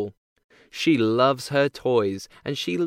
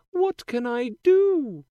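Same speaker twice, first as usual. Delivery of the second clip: put-on high, bright girlish princess voice; cartoon princess; 13 semitones higher, 3 dB louder than usual. A narrator's reading. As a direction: play a sweet young princess. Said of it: "she says".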